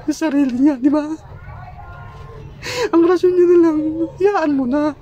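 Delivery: sobbing while speaking; high, tearful, breaking voice; crying. Real, not acted. Grieving husband recounts wife's death.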